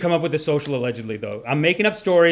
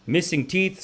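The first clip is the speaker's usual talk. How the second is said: ordinary talk, nothing marked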